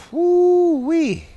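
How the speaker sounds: a high, delighted whoo-wee; gleeful cheer; excited whoop. Real, not acted. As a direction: let it fly up into a happy whoop. Excited whoop mid-pick.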